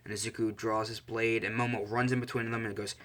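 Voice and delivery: ordinary delivery, nothing marked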